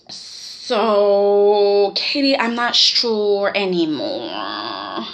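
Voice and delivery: low, slow, dragged-out mock doubt; mock wavering. Mocking Bill as he backs out on his wife, drawn-out wavering. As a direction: drag each word out, sinking low.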